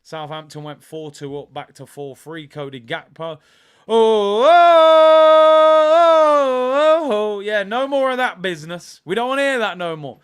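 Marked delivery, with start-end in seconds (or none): 3.9-7.4 loud, high rising oh oh at the goal; excited shouts. Real, not acted. Goal in a live match. 7.4-10.2 loud, fired-up gloating; excited. Real, not acted. Reacting to equaliser live.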